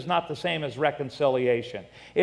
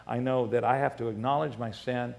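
soft, lowered, reverent preaching voice; hushed testimony; 5 semitones lower, 6 dB quieter than usual. Real, not acted. Preacher thanking God for his forgiveness, hushed and reverent. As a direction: drop low and quiet in gratitude.

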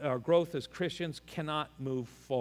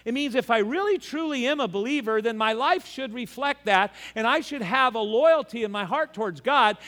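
raised, driving sermon voice, slowing at the end; preaching; 7 semitones higher, 5 dB louder than usual. Acted. Pastor drives home loyalty to the congregation, preaching. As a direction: press the point home from the pulpit.

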